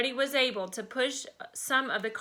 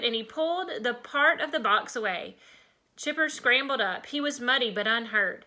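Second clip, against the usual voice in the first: usual speaking voice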